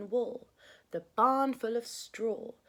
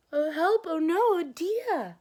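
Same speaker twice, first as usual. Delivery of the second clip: high and loud mock panic; alarmed gasp; 9 semitones higher, 10 dB louder than usual. A narrator's reading. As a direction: gasp then cry out in storybook alarm. Eggs vanish, narrator alarmed.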